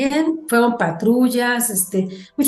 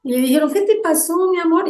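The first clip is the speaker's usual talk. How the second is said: tender greeting; 8 semitones higher than usual. Retold or imitated. Rescuers greeting the scared girl.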